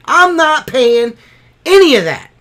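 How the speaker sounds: real indignant yelling; furious refusal. Real, not acted. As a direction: yell each phrase as a final no. Outburst refusing the payment plan.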